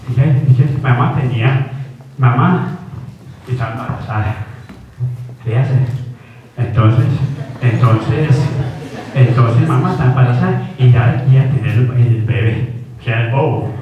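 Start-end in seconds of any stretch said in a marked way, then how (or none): none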